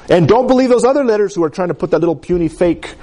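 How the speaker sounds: ordinary delivery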